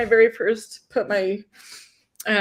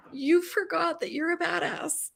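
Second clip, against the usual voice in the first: higher, laughing inner voice; amused inner thought; 7 semitones higher, 3 dB quieter than usual. Retold or imitated. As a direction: let your inner voice tease you with a laugh. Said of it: "had this thought".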